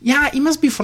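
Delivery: high mock caller voice; lady caller. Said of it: "She's like".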